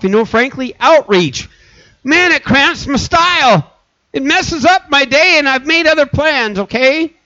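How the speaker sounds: high, whiny excuses to the pastor; mock churchgoer dodging Sunday service; excuse-making churchgoer. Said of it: "I've heard people say".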